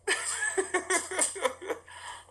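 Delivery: long, high, soft giggle; real laugh. Real, not acted. Laughing about police wanting his phone, real laugh. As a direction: giggle at your own cheek.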